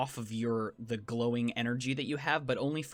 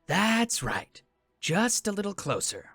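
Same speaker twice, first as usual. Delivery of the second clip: higher, eerie voice from the dark; dark whisper behind you; 6 semitones higher than usual. Acted. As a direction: coax them closer from the shadows. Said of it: "Again, the voice responds".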